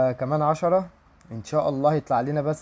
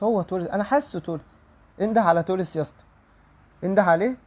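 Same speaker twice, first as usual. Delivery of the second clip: ordinary talk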